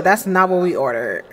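ordinary delivery

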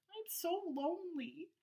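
a touch of sadness in a high little voice; sad Gilbert. Acted. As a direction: sigh it out small and lonely. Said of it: "said Gilbert sadly".

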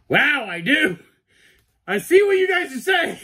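high, loud squealing excitement; excited shout. Real, not acted. Cosplayer sees the resemblance viewers mentioned, excited shout. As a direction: shout in giddy amazement, voice way up.

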